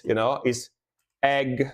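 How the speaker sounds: ordinary delivery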